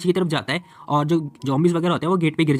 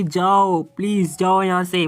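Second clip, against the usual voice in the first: higher, louder, slow pleading shout; 4 semitones higher, 5 dB louder, much slower than usual. Acted. Boy holding the door yells at her to flee, loud and slow. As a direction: drag out a desperate yell to go. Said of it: "वो बंदा चिल्ला रहा होता है".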